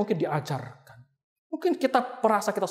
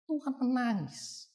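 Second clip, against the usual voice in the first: softer, slower, falling at the end; hushed statement; 3 semitones higher, 9 dB quieter, slower than usual. Shown as a demonstration. Preacher to congregation, dropping to a hushed, slow tone. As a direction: let it sink in quietly. Preacher stating Jesus wept.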